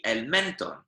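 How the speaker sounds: el mentón wrongly stressed on MEN; misplaced stress. Shown as a demonstration. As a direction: put the weight on MEN in el mentón. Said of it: "Because otherwise it would be".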